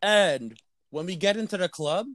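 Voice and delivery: ordinary delivery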